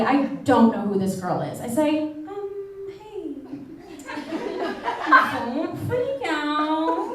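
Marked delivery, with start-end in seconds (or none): 2.0-7.1 mock polite, slow. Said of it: "again, very politely".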